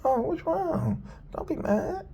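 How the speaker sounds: high, sweet coaxing voice; comforting voice. Retold or imitated. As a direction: coo it like soothing an upset pet. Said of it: "he actually looked after the other one".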